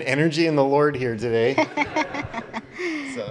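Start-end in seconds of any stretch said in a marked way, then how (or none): 1.6-3.1 a high, bright burst of laughter; high-pitched amused laugh. Real, not acted. Awkward moment after odd noise.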